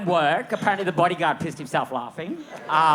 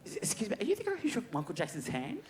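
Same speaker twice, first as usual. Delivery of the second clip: imitating queens; 6 semitones higher, 8 dB quieter than usual. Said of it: "they go".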